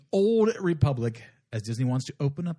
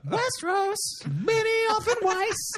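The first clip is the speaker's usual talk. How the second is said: mock high sing-song. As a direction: pipe the names up high like a taunt. Mocking high sing-song names.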